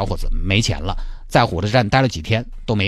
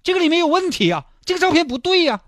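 put-on child's voice, pitched up high; boy telling grandpa the photo is wrong, high and childlike; insistent little boy; 12 semitones higher than usual. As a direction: protest like a puzzled six-year-old.

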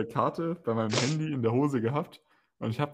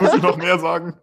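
laughing; 6 semitones higher, 8 dB louder than usual. Real, not acted. Joke about the train trip home.

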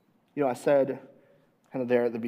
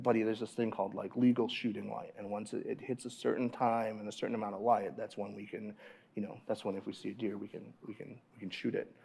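softer, murmured explanation; hushed explanation; 4 semitones lower, 11 dB quieter than usual. Retold or imitated. Father to daughter crossing dark field, hushed. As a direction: keep your voice low so deer don't hear.

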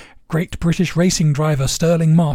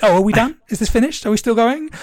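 higher-pitched, mock-impatient questions rising at the ends; 7 semitones higher, faster than usual. Bond asking a gleeful Le Chiffre if they're finished, impatient. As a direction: imitate someone itching to be done. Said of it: "getting so impatient".

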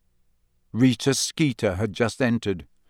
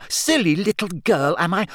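high, indignant echo of an insult; outraged mocking throwback; enraged; 6 semitones higher than usual. A narrator's reading. Hermione throwing Rita's insult back, enraged. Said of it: "shaking with rage".

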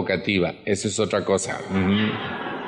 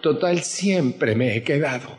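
higher, whiny martyr voice; self-pitying victim; 6 semitones higher than usual. Retold or imitated. Speaker mocking a family victim, whiny higher voice. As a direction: play the poor abandoned one.

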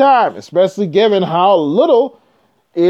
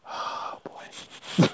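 breathy whisper with a laugh; whispered aside; 16 dB quieter than usual. Real, not acted. Vlogger whispers amused aside to viewers.

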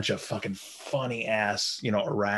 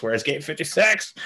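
higher-pitched, hammed-up lost passenger; stoned traveller; 5 semitones higher than usual. Stoned man searching the airport for his gate, mock bewildered. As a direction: call out the question, comically bewildered.

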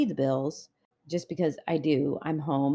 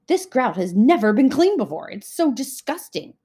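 loud, higher-pitched mock outrage; disgusted complaint; 6 semitones higher, 9 dB louder than usual. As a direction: imitate an appalled complainer, punching it out loudly. Voices husband scrubbing grout in disgust.

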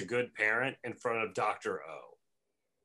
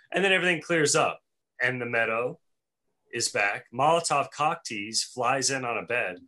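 ordinary talk

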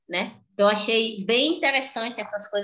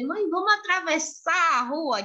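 put-on innocent child request; 6 semitones higher than usual. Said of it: "A estratégia dela é dizer".

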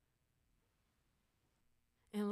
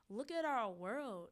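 ordinary talk, nothing marked

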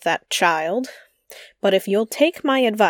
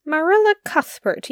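indignant; 8 semitones higher than usual. Acted. Lynde indignant at Marilla.

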